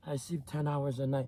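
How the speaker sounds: soft, low mimicry of an excuse; mocking sleeper. Imitating someone bragging about sleep, mocking and quiet. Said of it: "Talk about".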